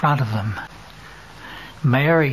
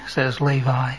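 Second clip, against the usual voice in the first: ordinary delivery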